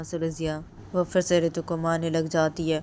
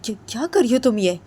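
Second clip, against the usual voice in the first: much higher, loud alarmed plea; shrill alarmed mother; 9 semitones higher, 12 dB louder than usual. Retold or imitated. Jiya's mother loudly pleading with bahu to come away. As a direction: cry out in shock at the bahu. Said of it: "बोलती है".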